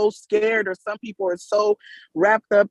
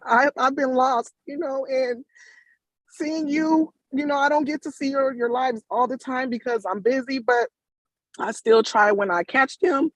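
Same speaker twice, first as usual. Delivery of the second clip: thanking her while choking up; tearful; 6 semitones higher than usual. Real, not acted. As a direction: voice higher, straining to hold back tears. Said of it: "I'm sorry I'm getting emotional".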